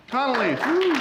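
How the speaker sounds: high whooping call-out; excited cheer. Real, not acted. Cheering on a panelist.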